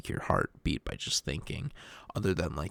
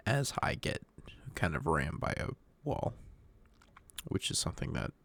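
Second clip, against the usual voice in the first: ordinary talk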